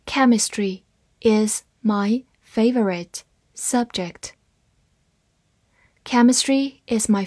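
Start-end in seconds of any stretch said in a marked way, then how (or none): 0.0-4.3 slow, word-by-word model sentence; slow reading. Shown as a demonstration. Teacher models the sentence for learners, slow reading. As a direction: read it slowly with gaps between words. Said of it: "慢读一遍".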